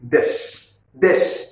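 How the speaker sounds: দেশ said alone, ending on the sh; teacher isolating the closed syllable দেশ; stopped syllable. Shown as a demonstration. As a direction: say দেশ, stop, pause, then repeat. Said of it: "এখানে দেখেন থেমে যাচ্ছে".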